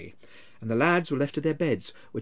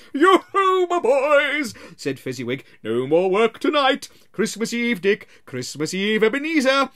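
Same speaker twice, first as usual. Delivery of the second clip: loud, high, hearty holiday call; a cheerful old master ending the workday; jovial Fezziwig; 9 semitones higher, 6 dB louder than usual. Acted.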